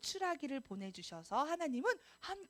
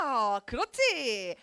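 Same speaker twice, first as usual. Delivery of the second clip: drawn-out praise; 8 dB louder, much slower than usual. Acted. Praising kids' answer.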